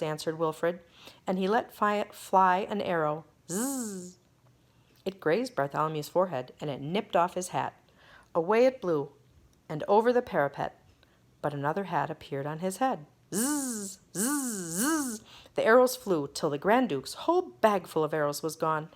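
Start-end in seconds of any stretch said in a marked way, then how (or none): 3.5-4.2 buzzing arrow. A narrator's reading. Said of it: "arrow sound". 13.3-15.2 buzzing arrows. A narrator's reading. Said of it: "arrow sounds".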